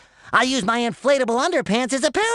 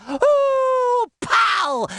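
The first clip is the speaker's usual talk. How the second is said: very high whoop into a pow; imitated bungee jump noise; 13 semitones higher than usual. SpongeBob acting out Gary's bungee jump, high whoop and pow. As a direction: whoop up high, then burst. Acts out Gary bungee jumping.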